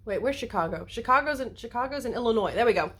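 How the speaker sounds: ordinary talk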